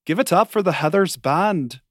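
put-on rousing shout-out in an Irish accent. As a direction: imitate an Irish actress at curtain call. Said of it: "in this beautiful Irish accent".

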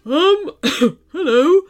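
polite, hesitant teddy. A narrator's reading. Teddy Marshall introduces himself to the children, politely hesitant. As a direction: clear your throat and greet them awkwardly. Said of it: "came a small and polite cough".

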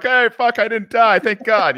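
high, loud relieved outburst; relieved exclaim. Retold or imitated. Looking back on combat, a loud relieved thank God. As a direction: blurt out your relief at surviving. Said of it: "I can look back and be like".

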